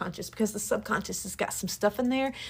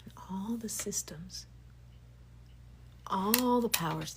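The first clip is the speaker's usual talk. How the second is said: hushed, breathy whisper that sinks under the words; whispered teaching; 8 dB quieter than usual. Acted. Telling listeners quietly how systems keep them ashamed, whispered. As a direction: lean in and whisper it like a secret. Whispered talk about control.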